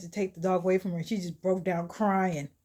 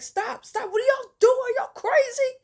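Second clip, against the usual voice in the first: high-pitched frantic yell; alarmed shouting; 7 dB louder than usual. Retold or imitated. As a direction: yell to break up a fight. Breaking up a fight.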